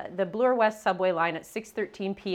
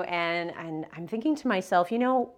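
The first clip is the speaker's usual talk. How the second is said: ordinary talk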